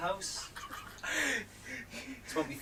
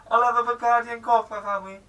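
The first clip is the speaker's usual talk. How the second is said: loud, raised mock-Welsh; higher, louder go at Welsh; Welsh accent; 6 semitones higher, 8 dB louder than usual. Shown as a demonstration. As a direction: push out a catchphrase in Welsh.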